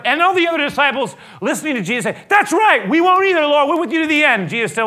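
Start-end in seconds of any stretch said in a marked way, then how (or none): 2.3-4.5 high, loud agreement; loud chorus. Acted. Disciples backing Peter, high and loud. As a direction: cheer him on.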